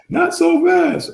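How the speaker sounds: high, slow, drawn-out "not so fast"; Lee Corso. Retold or imitated. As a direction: boom it out like a college football pundit. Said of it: "Lee Corso, can you hand me my Terp head?".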